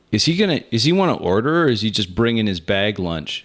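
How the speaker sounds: thick New York waitress voice; brassy New York diner waitress; New York accent. Acted. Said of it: "she says in her, a thick New York accent".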